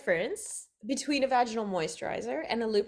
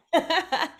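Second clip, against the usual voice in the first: a short bright burst of laughter; laughing; 9 semitones higher, 6 dB louder than usual. Real, not acted. Host giggling about the saucy little container, laughing. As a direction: laugh at the cheeky product name.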